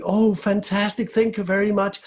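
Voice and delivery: high, bright relieved thanks; delighted. Acted. Whole household overjoyed as the fire finally burns. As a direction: burst out with joy.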